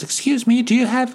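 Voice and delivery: put-on very high, sweet little old lady; high fragile granny voice. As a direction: chirp it like a gentle old neighbour. Old cat lady voiced by teller.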